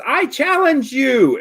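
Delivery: put-on high, loud, slow kid's yell; yelling challenge. Emil yelling a challenge into the tavern, high and loud. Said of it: "as he yells into the tavern".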